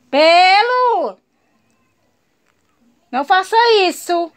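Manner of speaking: loud, sharp call to the dog; scolding shout. Real, not acted. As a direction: shout the pet's name in rebuke. Scolds dog after bite.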